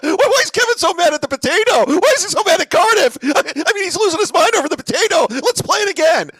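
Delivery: high, loud mocking host voice; hammed-up high-pitched impression of Carl. Mocking a rival host, high and loud. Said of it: "Here's my Carl".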